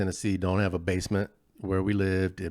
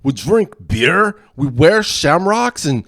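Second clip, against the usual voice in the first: loud, much higher stage Scots-Irish brogue; cartoon Scottish-Irish accent, pitched up and loud; hammed-up Scottish-Irish accent; 11 semitones higher, 11 dB louder than usual. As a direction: play a gruff Scotch-Irish lout, loud and high. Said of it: "I always wanna be good at accents".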